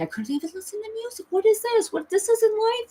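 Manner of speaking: high, frantic lament; desperate protest. Retold or imitated. Telling her guide this isn't living, desperate. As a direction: protest in a high, distraught pitch.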